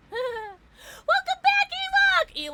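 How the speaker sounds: very high, loud, drawn-out welcome; excited welcome. Real, not acted. Streamer welcoming a returning viewer, squealed greeting.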